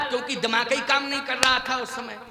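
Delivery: ordinary talk, nothing marked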